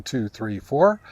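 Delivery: ordinary delivery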